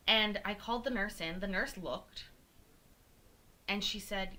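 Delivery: normal talking voice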